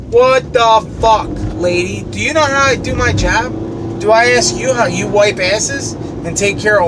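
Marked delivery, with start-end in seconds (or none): 0.1-2.0 a drawn-out, louder what the fuck; venting at her message, drawn out and loud; exasperated outburst. Real, not acted. Ranting at the nurse's voicemail, exasperated and drawn out.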